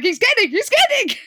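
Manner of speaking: very high, hurried-sounding mock protest; mock panic. [name] hastily denies what Maddie blurted out, in mock panic. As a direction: imitate someone frantically backpedalling.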